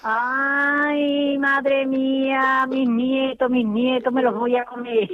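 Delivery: a tender, slowed ay madre mía; fond exclamation. Real, not acted. [name] learns her grandchildren pranked her, fond and amused. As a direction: melt at hearing about the grandkids.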